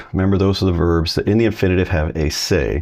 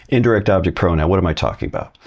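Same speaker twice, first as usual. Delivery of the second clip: ordinary talk, nothing marked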